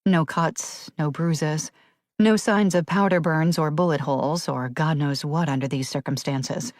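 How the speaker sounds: plain reading voice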